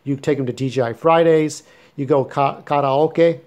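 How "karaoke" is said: karaoke said the Japanese way, kah-rah-oh-keh; Japanese pronunciation. Shown as a demonstration. Vlogger correcting how viewers say karaoke, Japanese pronunciation. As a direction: say karaoke with open Japanese vowels, ending in keh. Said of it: "that's the Japanese pronounce".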